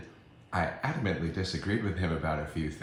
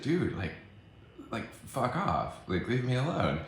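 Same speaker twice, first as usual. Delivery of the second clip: exasperated brush-off; 6 semitones higher than usual. Retold or imitated. Woman or man tells attendee to leave, exasperated. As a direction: retell it as a fed-up dismissal. Retold quarrel, telling him to go.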